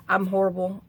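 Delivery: ordinary talk, nothing marked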